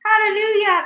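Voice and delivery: very high loud hallelujah; jubilant shout. Acted. Aunt dancing round the tree, jubilant shout. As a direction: shout for joy. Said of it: "Aunt Spiker shouted".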